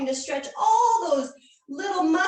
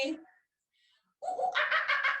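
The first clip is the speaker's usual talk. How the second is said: much higher, softer monkey hoots; monkey call; 13 semitones higher, 3 dB quieter, slower than usual. Shown as a demonstration. Yoga teacher showing kids the monkey sound, high hoots. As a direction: hoot like a playful monkey.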